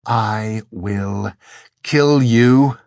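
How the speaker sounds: deliberate threat swelling loud; pause, then hit the threat hard and loud; cold hatred. A narrator's reading. Angel threatening Dor with pure hatred, cold fury. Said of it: "only hatred, pure and honest and cold".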